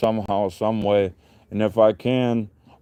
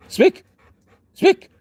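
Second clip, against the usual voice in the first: much higher, louder squeal of Speak; excited high command; 6 dB louder than usual. Real, not acted. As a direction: egg the dog on with a high, loud call. Commanding dog to bark.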